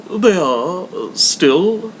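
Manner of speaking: a courteous charity collector; hammed-up polite visitor; portly gentleman.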